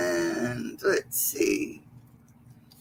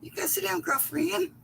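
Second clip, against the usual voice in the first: pet talk; 10 semitones higher, 7 dB quieter than usual. Real, not acted. Talking to her dog.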